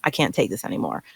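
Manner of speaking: a tired man who has had enough; low, quiet, done with it; imagined Lex. Retold or imitated. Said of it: "Lex would have been like".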